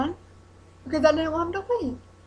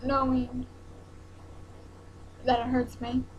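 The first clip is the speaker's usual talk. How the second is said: choked up and cracking, real tears close; voice breaking; 5 dB quieter than usual. Real, not acted. Telling the camera her boyfriend's hurt, voice catching. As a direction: let the throat tighten as the words come out. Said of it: "see, I'm getting emotional now".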